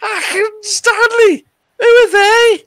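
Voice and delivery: put-on very high, loud squeaky kid voice; loud, piping schoolboy impression; incredulous advert schoolboy. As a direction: squeal it in disbelief like a little lad. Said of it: "Ian Rush says".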